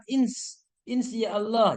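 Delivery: "ins" cut short, then "insya Allah" with "si-a" split apart; mock a faulty "insya Allah"; wrong pronunciation. Shown as a demonstration. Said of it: "Jangan begitu".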